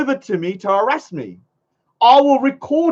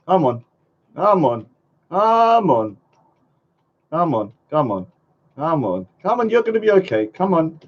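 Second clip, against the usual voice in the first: put-on lower, hushed cooing to a baby; mock soothing; 6 semitones lower than usual. Rocking the illegitimate little brat to sleep, mock hushed cooing. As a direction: pretend to lull a crying child.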